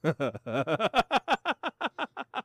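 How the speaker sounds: staccato laugh rising in pitch; long run of ha-has. Real, not acted. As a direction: chuckle climbing into a cackle. Laughs at own sarcasm.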